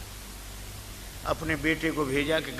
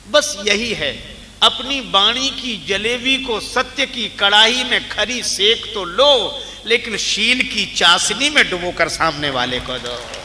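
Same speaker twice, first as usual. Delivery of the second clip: preaching moral; 5 semitones higher, 8 dB louder than usual. Acted. Preacher urging listeners to speak truth sweetly, loud and emphatic. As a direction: drive the lesson home with force.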